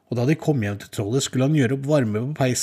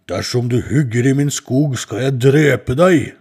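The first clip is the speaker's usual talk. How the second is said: loud, slow threatening troll; 2 semitones higher, 8 dB louder than usual. Acted. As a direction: boom it out like a menacing troll. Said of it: "sa trollet".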